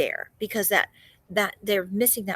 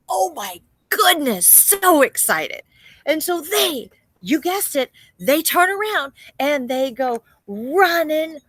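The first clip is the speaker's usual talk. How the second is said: loud, high, thrilled storytelling; bursting with excitement; 7 semitones higher, 10 dB louder than usual. Acted. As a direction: stretch it out, breathless with big news. Disciples thrilled, running to tell.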